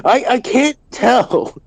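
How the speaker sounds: high, loud, slow protest; loud, high-pitched exasperation; exasperated outburst. Real, not acted. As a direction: cry it out in real frustration. Frustrated reply to being called mumbling.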